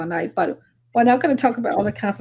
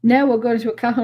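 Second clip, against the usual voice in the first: normal talking voice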